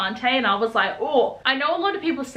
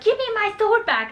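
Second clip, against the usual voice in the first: whiny demand; 10 semitones higher than usual. Retold or imitated. Zara demanding her sword.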